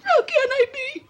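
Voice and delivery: very high, slow crying protest; bawling self-pity, drawn out; tearful wail. Acted. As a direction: wail the question through tears. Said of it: "I am crying".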